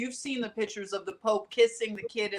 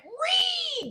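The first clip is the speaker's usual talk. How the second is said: a sudden squeal, much higher, mocking angry critics; mock screech. Angry Pope defenders mocked with a high squeal. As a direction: squeal it like an outraged online mob. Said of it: "They went".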